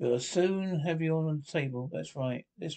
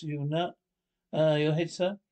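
usual speaking voice

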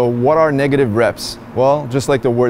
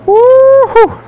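whooping cheer; 7 dB louder than usual. Real, not acted. Shout after a heavy lift.

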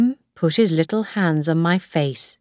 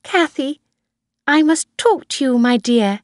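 put-on higher-pitched older employer; Mrs Harvey; 8 semitones higher, 3 dB louder than usual. A narrator's reading. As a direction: voice a grandmother summoning the nanny. Grandmother summons Kathy to talk.

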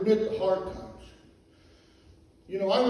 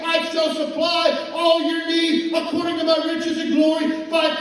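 fiery preaching; 7 semitones higher, 6 dB louder than usual. Acted. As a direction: lift the church to praise. Preaching, building to praise.

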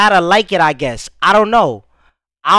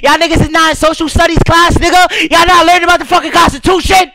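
rapped in a raised, loud voice; high voice; 6 semitones higher, 6 dB louder than usual. Acted. Rapper performing over the track in a high voice. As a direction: push the pitch up and shout the bars. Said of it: "I had to put the high voice on".

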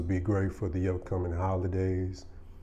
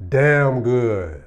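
loud emphatic praise; 5 semitones higher, 13 dB louder than usual. Real, not acted.

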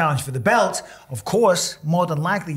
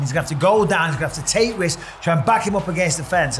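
usual speaking voice

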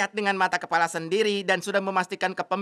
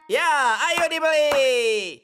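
slow hawker's shout; 6 semitones higher, slower than usual. Acted. Father calling customers loudly.

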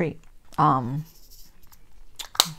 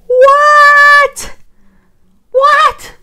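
shocked high shriek, much louder; screamed; 13 dB louder than usual. Retold or imitated. Caller screaming at the jury clerk in shock. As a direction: scream it in disbelief. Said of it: "I basically screamed".

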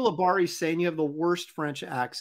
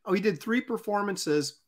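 ordinary delivery

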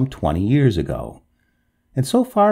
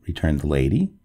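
ordinary delivery

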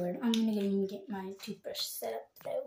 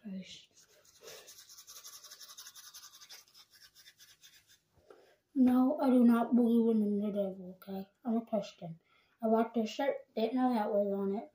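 talking while brushing teeth; words slurred around brush; toothbrush in mouth; 3 dB louder than usual. Real, not acted. Seen 1: Toothbrush in mouth throughout the labelled words; not in the R frames.

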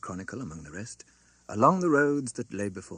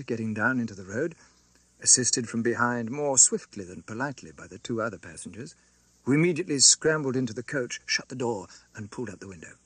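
usual speaking voice

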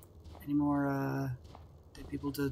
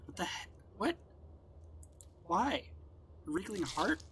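high-pitched, incredulous questioning; baffled surprise; 6 semitones higher, 6 dB quieter than usual. Real, not acted. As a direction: blurt it out in real bewilderment. Baffled by unexpected game items.

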